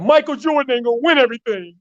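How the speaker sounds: high, fast, fired-up vow; young Kobe vowing. Retold or imitated. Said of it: "And then he said".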